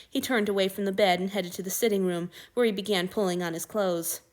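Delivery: plain reading voice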